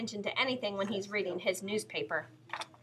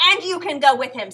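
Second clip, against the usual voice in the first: louder scolding order; angry mother; 4 semitones higher, 11 dB louder than usual. Acted. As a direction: send her out too, in a loud stern voice. Mother scolds sister too.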